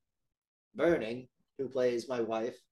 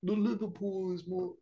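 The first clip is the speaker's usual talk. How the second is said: a light attempt at a Liverpool accent, a bit higher; half-hearted Scouse try; 6 semitones higher, 3 dB quieter than usual. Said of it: "I can do a Liverpool".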